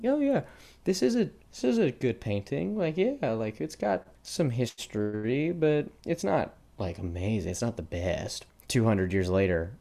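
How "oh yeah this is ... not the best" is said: much higher put-on voice, slowing on the shrug; mock unimpressed art viewer. A podcaster voices an unimpressed Renaissance viewer, high and mocking. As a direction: play a bored viewer calling it just fine.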